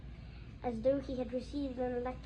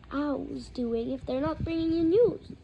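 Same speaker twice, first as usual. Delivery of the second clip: ordinary delivery, nothing marked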